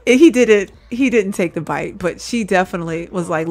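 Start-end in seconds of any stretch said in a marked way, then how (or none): none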